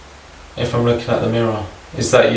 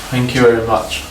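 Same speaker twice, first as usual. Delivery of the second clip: ordinary delivery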